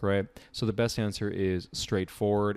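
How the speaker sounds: ordinary delivery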